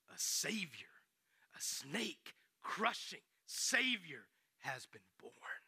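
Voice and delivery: like telling a sacred secret; slow breathy announcement; awed whisper. Acted. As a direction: whisper the news slowly, with wonder. Angel's announcement, hushed.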